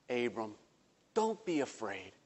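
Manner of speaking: soft, tender comfort; God reassuring. Acted. God softly comforting the fearful Abram, tender and quiet. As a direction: hush his fear gently. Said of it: "Listen to what he says".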